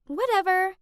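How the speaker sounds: high, loud brush-off; snotty, dismissive voice; dismissive shrug. A narrator's reading. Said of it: "she just shrugged and said".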